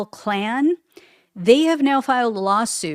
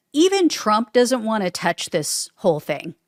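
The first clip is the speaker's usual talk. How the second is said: ordinary talk, nothing marked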